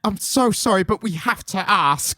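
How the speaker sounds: high, polite nervous interviewer voice; Alan Moore impression. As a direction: timidly ask the grumpy writer. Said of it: "we both do an Alan Moore impression".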